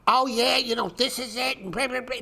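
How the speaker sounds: high, far-off smug guru voice; mocking chart painter. Mimicking chart painters for his viewers, high smug guru voice. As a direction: parody a trader sure of his call. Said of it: "because I'm like".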